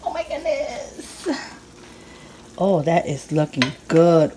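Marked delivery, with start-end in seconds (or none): 0.1-1.3 soft, high, slow exclamation; delighted amazement. Real, not acted. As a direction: squeak it out slowly, barely aloud. Awed at the cooked food.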